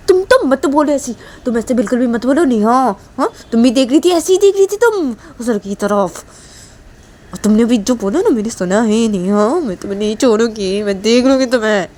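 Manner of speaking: put-on indignant girl telling someone off; angry, threatening friend. A narrator's reading. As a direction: turn the accusation back on your friend. Voices angry Sneha.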